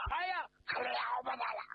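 high, quick cry of alarm; dizzy panic. Acted. Officer facing the rushing flood, panicked. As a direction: blurt it out high and fast in sudden fright. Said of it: "他一见水可眼晕了".

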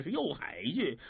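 ordinary delivery

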